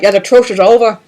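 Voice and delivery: mock angry warning. Acted territorial quarrel in film retelling.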